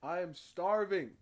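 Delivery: loud blurted complaint; starving. Real, not acted. Streamer to chat, loud hungry blurt.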